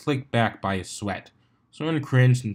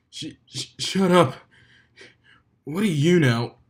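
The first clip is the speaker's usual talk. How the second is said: stammering, then loud and higher; flustered stallion; 6 semitones higher, 3 dB louder than usual. A narrator's reading. Dumbbell snaps at the laughing Wonderbolt, loud and flustered. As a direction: snap back, embarrassed.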